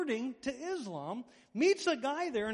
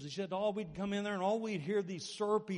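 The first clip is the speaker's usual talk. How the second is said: usual speaking voice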